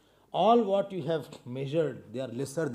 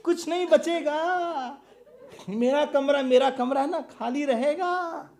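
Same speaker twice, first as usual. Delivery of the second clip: speaking through laughter, higher; laughing while picturing the empty room; 10 semitones higher, 5 dB louder, faster than usual. Real, not acted. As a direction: chuckle out the empty-room joke.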